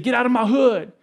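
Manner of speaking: mock threatening yell from the porch; gruff shout. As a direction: bark it like a threatening local. Thugs confronting him on street.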